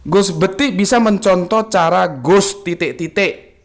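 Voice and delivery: reading a viewer's greeting on air; slow comment reading. Acted. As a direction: read each word of the greeting carefully.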